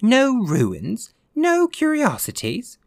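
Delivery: very high-pitched, stretched exclamation; incredulous echo. A narrator's reading. Ghost answering Virginia's remark on ruins, incredulous. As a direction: throw it back in high-pitched disbelief.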